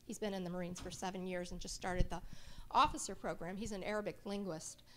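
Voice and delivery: ordinary delivery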